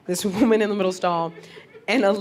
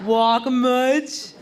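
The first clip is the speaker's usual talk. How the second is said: put-on slow, taunting child laugh; slow mocking kid voice; 4 semitones higher, much slower than usual. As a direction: jeer like a kid at someone who fell. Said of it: "you're like".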